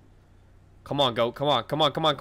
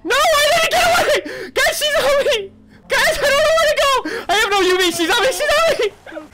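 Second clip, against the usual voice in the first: very high, tearful-sounding screams; panicked wailing. Real, not acted. Player chased with no UV, panicked wailing. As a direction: wail for help at top pitch.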